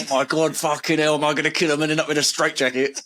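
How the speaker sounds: high, fast-building hammed-up exasperation; mock panic. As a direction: play the parent at wit's end. Acted frazzled parent losing it.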